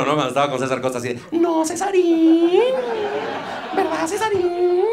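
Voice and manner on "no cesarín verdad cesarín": put-on very high, slow childlike voice; Chabelo impression. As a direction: coo like a little boy puppet. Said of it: "Como Chabelo". (imitando a Chabelo con voz muy aguda y lenta de niño)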